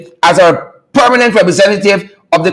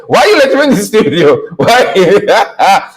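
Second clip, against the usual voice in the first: quick, laughing retort; quoting a studio guest mid-laugh; laughing quote. Retold or imitated. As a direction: spit it out fast through laughter. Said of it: "looked at me and said".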